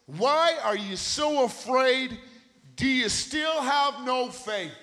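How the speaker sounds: slow, loud, high challenging question; impassioned booming question; loud rebuke. Acted. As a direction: press them hard on their fear. Jesus questioning disciples, loud.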